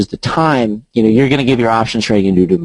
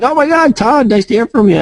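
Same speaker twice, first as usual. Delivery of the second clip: put-on gushing old friend; 11 semitones higher than usual. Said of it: "guys I hadn't heard from in twenty years were like".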